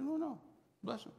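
reassuring the waiter it's real; eager insistence. Retold or imitated. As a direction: wave off the doubt gladly.

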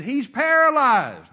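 slow loud yell from far off; yelled back. Retold or imitated. Men yelling back to the preacher, slow loud shout. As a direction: shout it back across the crowd.

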